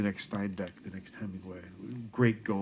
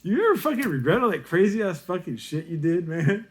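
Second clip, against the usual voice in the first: loud, high-pitched challenge; retold incredulous question; 10 semitones higher, 9 dB louder than usual. Teacher asking Bruce about regrets, loud and high retold question. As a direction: raise the voice, putting it to a buddy. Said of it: "I said".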